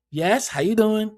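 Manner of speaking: lightly changed polite phone greeting; put-on phone voice. To a scam caller, a lightly put-on polite voice. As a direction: answer the scam call in a put-on voice. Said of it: "With another voice and everything".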